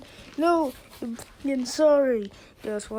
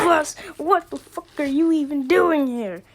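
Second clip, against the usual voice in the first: ordinary talk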